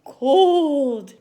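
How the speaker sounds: shivering cold. Shown as a demonstration. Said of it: "Make yourself shiver".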